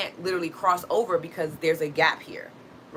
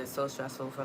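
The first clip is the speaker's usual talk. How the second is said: ordinary talk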